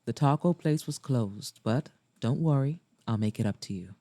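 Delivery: lightly voiced man, sinking low at the end; Maxwell. Maxwell reassures her, in character.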